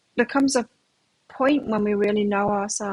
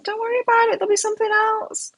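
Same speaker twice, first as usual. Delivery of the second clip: high, gentle reassurance; reassuring mom; 13 semitones higher, 3 dB louder than usual. Retold or imitated. Mother comforting her son after he quit, reassuring. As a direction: soothe your upset son, lightly high. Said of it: "I said".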